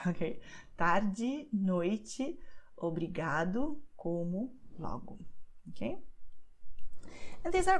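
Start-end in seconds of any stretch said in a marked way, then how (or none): 0.8-5.2 Tarde, noite with final i, obrigado, como, logo with final u; final vowels raised. Shown as a demonstration. Teacher repeating examples so learners hear the endings. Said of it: "let me just repeat these examples".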